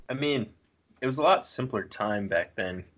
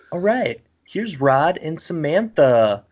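pitched-up, hesitant presenter voice; mock TV show announcer; 7 semitones higher, 5 dB louder, slower than usual. Mocking a House Hunters couple introduction, high announcer voice. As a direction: present the contestants in a raised, sing-out voice.

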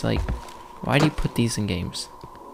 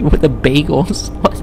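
ordinary delivery

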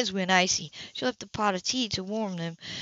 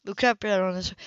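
usual speaking voice

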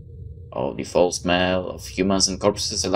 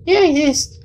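squeaky self-reply; 7 dB louder, slower than usual. Acted. High answering self.

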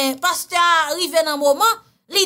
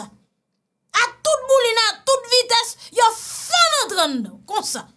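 put-on drunk with hiccups; 9 semitones higher than usual. High slow mock drunk rush.

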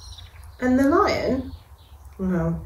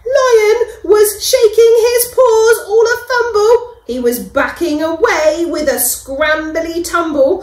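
much higher and louder, breathless telling; excited narration; 9 semitones higher, 9 dB louder than usual. A narrator's reading. Narrator describing the panicking lion, high and excited. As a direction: tumble through it in a rush.

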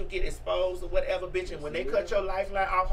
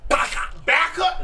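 sharp, loud "back up" climbing higher; mock warning shout; 6 semitones higher, 4 dB louder, slower than usual. A parent warning a bully's mother to back off, loudly. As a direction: bark it at the bully to step off.

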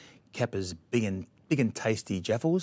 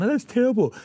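high, louder mock gasp of shock; mock shocked girl; 13 semitones higher, 6 dB louder than usual. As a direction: play a scandalised girl, pitched way up. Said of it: "First she was going".

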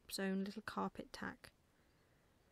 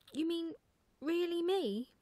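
high, loud owlet's disbelief; astonished little child's voice; amazed delight; 8 semitones higher, 6 dB louder than usual. Acted. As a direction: ask it thrilled, voice pitched right up. Owlet amazed at being drawn.

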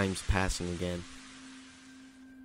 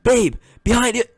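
loud high-pitched warning yell; alarmed shout; 14 semitones higher, 12 dB louder than usual. Acted. Boyfriend warns girlfriend of danger behind, alarmed shout. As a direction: shout a sudden warning, voice leaping up.

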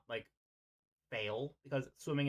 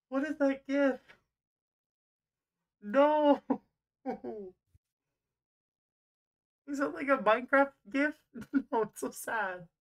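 high, loud disbelief at a gif, laughing; shocked squeal; 11 semitones higher, 7 dB louder than usual. Real, not acted. Game master reacting to a gif players shared, shrieking in disbelief.

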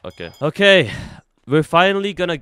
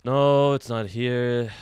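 ordinary talk

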